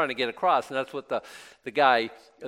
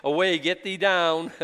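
God's voice; 4 semitones higher, 5 dB louder than usual. Retold or imitated. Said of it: "God dismissing Moses".

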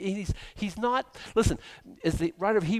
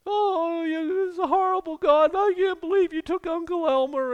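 very high, wailing rant; hammed-up, high-pitched bitter outcry; mock vitriol; 14 semitones higher, 5 dB louder than usual. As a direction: imitate a bereaved person railing at God. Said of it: "this vitriol poured out at God".

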